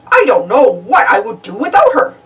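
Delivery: fond, grateful baker. A narrator's reading. Baker telling Donald how much Daisy helps.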